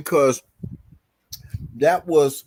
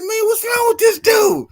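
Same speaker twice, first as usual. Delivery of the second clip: high, loud exasperated shout about the dude; retold shout; 6 dB louder than usual. Coworker shouting his disbelief to the narrator. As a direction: bellow it in disbelief. Coworker exclaims after the restaurant scene.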